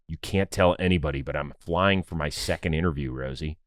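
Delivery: hushed and low, imitating a friend; real quiet voice. Friend confiding a secret interview by phone, quiet voice. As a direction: retell it in a low, soft, confiding voice. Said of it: "answers in a real quiet voice".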